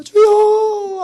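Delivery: long, high, loud anguished wail; 주여 wailed high and long, as if sobbing; crying out to God. Retold or imitated. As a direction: stretch "주여" into a drawn-out cry. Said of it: "하고 막 울부짖었어요".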